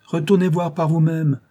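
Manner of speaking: loud, high, dismissive order; stern, impatient retort; curt dryness. A narrator's reading. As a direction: send her away sharply. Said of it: "répondit Lady Audley sèchement".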